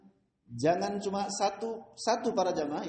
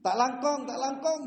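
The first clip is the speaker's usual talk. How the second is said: high squeaky Madurese thank-yous; the grateful rat squeaking in Madurese; 6 semitones higher than usual. Retold or imitated. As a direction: tikus berterima kasih dalam bahasa Madura dengan suara tinggi. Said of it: "Kalau Maduranya gimana?".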